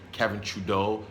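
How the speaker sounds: normal talking voice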